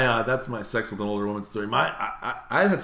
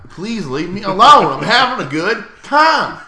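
louder, higher, slow mock whining; drunk whine; 9 semitones higher, 13 dB louder, slower than usual. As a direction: drag it out like a tipsy brush-off. Drunk friend whining to stay.

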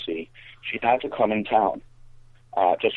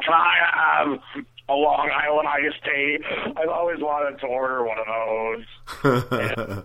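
high, slow, drawn-out caricature; annoying friend's voice; 5 semitones higher than usual. Retold or imitated. Friend ordering a drink at the bar, annoying put-on voice. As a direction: stretch each word in a mock-impaired voice. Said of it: "I can't believe this girl talks like that".

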